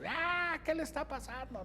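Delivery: high-pitched panicked shriek, imitating a frightened lady; mock his partner's shrill woman's scream. Cameraman shrieking in panic during the beating, high mock scream. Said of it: "Noé ya gritaba como señora así".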